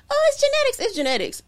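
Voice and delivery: high mock singsong brush-off; mocking dismissive. Mocking people who credit genetics, singsong and dismissive. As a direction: parody the know-it-alls. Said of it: "and everybody like".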